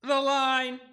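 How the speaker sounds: loud, high-pitched title call; show title announcement. Acted. Co-host announces the show's name, announcer style.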